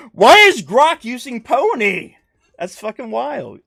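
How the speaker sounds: high, loud, slow incredulous question; can't believe the word choice; amused disbelief. Real, not acted.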